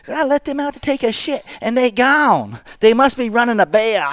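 very high, exaggerated Southern voice; cartoonish country oddball, drawling out the words way up high; hammed-up high hillbilly impersonation; Crazy Bobby. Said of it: "He goes".

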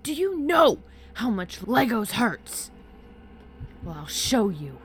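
loud raging rant, high and strained, dropping into a threat; furious roar. A narrator's reading. Drunk father raging at his daughter over toys, furious roar. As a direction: bellow it like an enraged father. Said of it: "he roared now".

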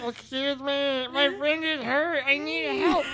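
very high, slow squeaky Muppet voice; imitating a silly puppet; mocking Muppet voice. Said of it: "it sounded like".

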